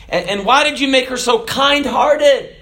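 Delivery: lightly acted, high-pitched wondering question; husband questioning God. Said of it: "and then said".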